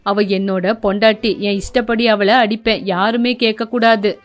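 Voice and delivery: a cold, defiant husband; voiced threatening son.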